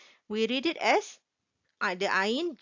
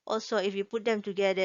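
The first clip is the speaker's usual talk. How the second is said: normal talking voice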